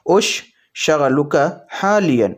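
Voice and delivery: slow, careful "اش شغلک حالین"; slow Arabic demo. Shown as a demonstration. Teacher modelling the Arabic question slowly for viewers. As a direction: stretch out "اش شغلک حالین" word by word. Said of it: "آپ اس طرح سے پوچھیں گے".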